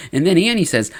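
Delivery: ordinary talk, nothing marked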